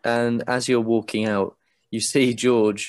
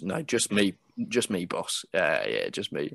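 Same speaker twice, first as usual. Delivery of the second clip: lower, softer, leaning in conspiratorially; low close voice; 3 semitones lower, 6 dB quieter than usual. Acted. Man leaning in close to the guest, voiced low. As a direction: murmur it close to the ear. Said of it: "he leans in really closely and goes".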